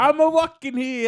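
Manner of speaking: high, slow mock Brooklyn; Brooklyn accent. Doing the Brooklyn bit for friends, mock New York accent. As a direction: classic New York tough guy. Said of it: "You got my Brooklyn one".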